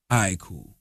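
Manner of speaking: retold shrug. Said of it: "So I'm like".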